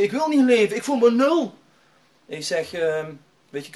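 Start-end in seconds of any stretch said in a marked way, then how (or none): 0.0-1.5 high, loud desperate outburst; imitated sobbing despair. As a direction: act out despair boiling over. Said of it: "hij was echt een snikken".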